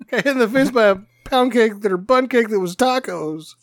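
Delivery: normal talking voice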